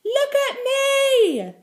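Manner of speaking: put-on very high, drawn-out child's shout; loud voice. A narrator's reading. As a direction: yell it slowly for all the street to hear. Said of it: "called in such a loud voice".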